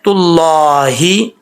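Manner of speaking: slow तुल्लाही with long heavy ला; correct reading. Shown as a demonstration. Teacher answers what is correct, careful tajweed. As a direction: hold तुल्लाही out, ending on a clear ee. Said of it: "तो ठीक क्या है".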